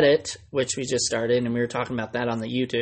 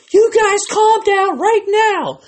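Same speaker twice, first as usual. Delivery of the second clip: mock outraged yell, high and slow; really upset; 8 dB louder, slower than usual. Podcaster imitating the upset lady running the show, high loud scolding. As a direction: imitate a flustered organizer scolding a rowdy crowd. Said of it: "got really upset".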